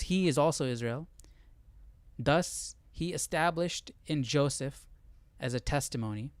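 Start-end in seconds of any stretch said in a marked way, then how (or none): none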